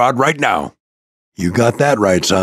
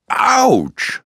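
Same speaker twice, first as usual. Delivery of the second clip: high, drawn-out yelp of pain; pained cry; 11 semitones higher than usual. Acted. Pilot releasing the electric charge, pained yelp. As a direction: cry out as the charge zaps you.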